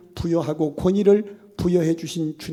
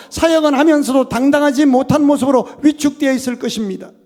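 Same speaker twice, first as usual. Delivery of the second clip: loud, higher, driving sermon voice; fervent preaching; 7 semitones higher, 10 dB louder than usual. Acted. Pastor warns the congregation, loud fervent preaching. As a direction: preach it out with full force.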